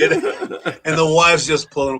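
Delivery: normal talking voice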